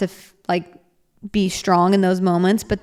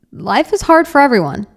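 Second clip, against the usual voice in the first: louder and higher, leaning on is; emphatic statement; 6 semitones higher, 6 dB louder than usual. Real, not acted. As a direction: insist that life is hard. Emphatic point to the audience.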